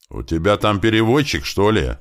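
mildly acted exasperated yell; exasperated shout. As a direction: call out, losing patience. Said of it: "не выдержав, выкрикнул Туман".

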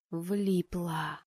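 subdued, glum inner thought; quiet, rueful gloom; gloomy. A narrator's reading. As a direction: think it darkly to yourself. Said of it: "мрачно подумала я".

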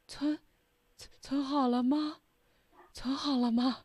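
high, shaky, fearful asking; trembling nervous voice. Shown as a demonstration. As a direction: ask it trembling with fear. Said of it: "就这种".

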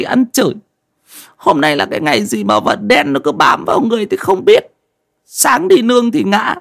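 high-pitched whiny complaining; peeved young man griping; grumbling. A narrator's reading. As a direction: grumble bitterly to yourself while walking. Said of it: "Vừa đi vừa cằn nhằn".

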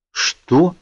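high, outraged question; angry. A narrator's reading. Brother hearing she won't stay with mother, angry. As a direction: jump up in pitch, affronted. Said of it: "гневно переспросил Калин".

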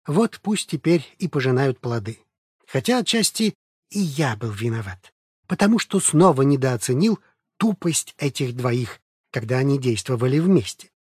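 usual speaking voice

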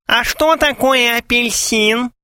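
high, slow squeak of a mock fiancée; squeaky voice. A narrator's reading. Gromov as clueless beloved questions suitor, squeaking. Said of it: "снова пропищал Громов".

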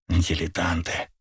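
slow, soft scornful whisper. A narrator's reading. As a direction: whisper it with contempt. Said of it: "прошептал Лакин".

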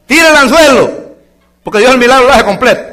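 high, loud exhortation; preaching shout. Real, not acted. Pastor urges the church to cast the hook, shouting. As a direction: cry it out to the church. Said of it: "exhorting congregation".

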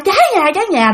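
retold high-pitched fire cry; raising the alarm. Aunt next door warning of fire, raising the alarm. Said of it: "cái mợ đó la".

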